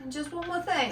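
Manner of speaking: half-hearted, soft detective attempt; Columbo. Co-host who can't do voices, faint impression. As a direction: try the detective voice weakly, giggling. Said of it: "I can't do voices".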